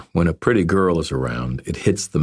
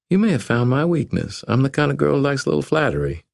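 put-on higher, playful young woman; 10 semitones higher, faster than usual. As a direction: tease him like a Southern belle.

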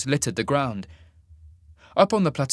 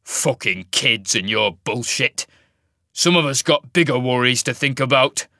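gruff, irritated grumble getting louder; snarling; 6 dB louder than usual. A narrator's reading. Wolf man complaining about squabbling kids, snarling. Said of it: "he snarled".

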